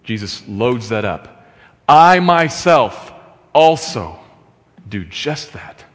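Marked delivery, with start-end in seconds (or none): 1.9-5.8 "I myself" slow and louder, then fading; stress on myself. Acted. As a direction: lean hard on "myself", let the rest fall away. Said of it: "Emphasis on me in the text".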